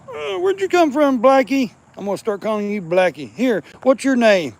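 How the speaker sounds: high, loud coaxing voice. Real, not acted. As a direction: coo up high at the kitten. Talking to kitten in high voice.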